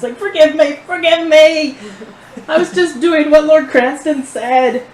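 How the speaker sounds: high, loud, frantic begging; grovelling gnome. Acted. Cowed gnome crawling to his intimidator, pleading. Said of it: "he crawls towards you".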